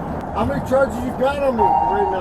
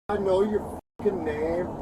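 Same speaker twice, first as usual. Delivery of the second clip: usual speaking voice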